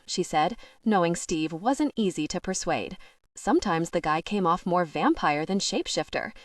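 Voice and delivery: usual speaking voice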